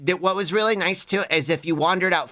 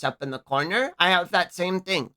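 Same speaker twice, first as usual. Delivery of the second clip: ordinary delivery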